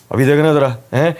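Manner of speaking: ordinary talk, nothing marked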